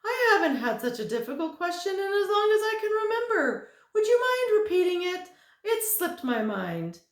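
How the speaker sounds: very high, loud, fast chatter; giddy thin man. Acted. Thin man gushes to Milo, high and fast. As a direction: gush without stopping for breath.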